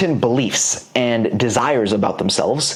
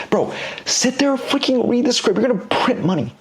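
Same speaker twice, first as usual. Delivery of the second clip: exasperated plea; 10 semitones higher than usual. Real, not acted. Pleading with students to follow script.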